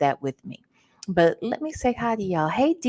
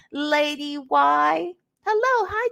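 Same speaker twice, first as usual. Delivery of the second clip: ordinary talk, nothing marked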